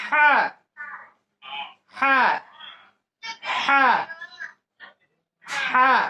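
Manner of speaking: "হ্যাঁ" as a high, forceful breathy ha from mid-throat; laugh-like ha. Shown as a demonstration. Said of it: "একটু হাসার আওয়াজ দিয়ে বলতে হবে". (হাসির আওয়াজ দিয়ে উঁচু গলায় "হ্যাঁ")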